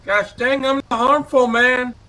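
ordinary delivery

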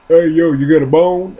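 a hint of a street dog. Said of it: "that dog was like".

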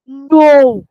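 loud booming no. Acted. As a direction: boom it like the wind. Wind answers Mouse.

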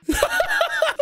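very high cackling laugh; gleeful laughter. Real, not acted. Prankster after friend screams, high gleeful laughter.

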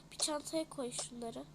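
normal talking voice

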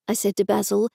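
ordinary delivery

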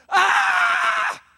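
high, loud imitated holy scream; ecstatic scream. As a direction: scream like the Spirit just hit you. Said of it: "You gonna go".

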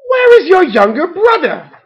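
loud, high demand from a worried mom; alarmed mother. A narrator's reading. Mother duck to the returning ducklings, alarmed. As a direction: question them in rising alarm.

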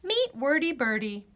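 usual speaking voice

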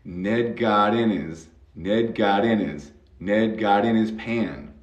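his said as "is" with no H; H of his dropped. Shown as a demonstration. Teacher to student, dropping the H in his. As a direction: cut the H so "his" runs into "in". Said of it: "Vamos a cortar esa H".

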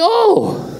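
high startled oh; surprised gasp. Retold or imitated. Preacher retelling seeing the presidential car, high startled oh. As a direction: catch your breath at the sight.

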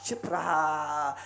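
slow, low, soft refrain; drawn-out quiet fall; hushed silence. Shown as a demonstration. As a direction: let it sink slowly down. Husband's silence after insult.

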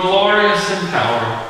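ordinary delivery

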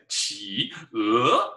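企鹅 said in a funny odd voice; teacher's playful wrong version; silly way. Shown as a demonstration. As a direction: play 企鹅 for fun, the wrong way. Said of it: "don't say it like that".

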